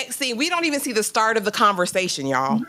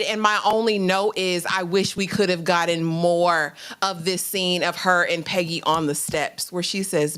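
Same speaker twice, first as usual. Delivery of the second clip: ordinary talk, nothing marked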